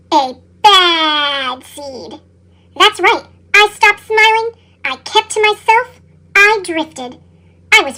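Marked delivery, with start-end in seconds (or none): 0.1-2.2 very slow, low and brooding; a lower, sorrowful repeat; slow dramatic echo. A narrator's reading. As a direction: drag it out with gloom.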